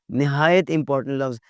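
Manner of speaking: usual speaking voice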